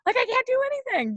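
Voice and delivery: high-pitched, overwrought despair; reenacted emotional meltdown, pitch soaring; having a meltdown. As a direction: spiral upward in self-blame. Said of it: "Just, like, having a moment".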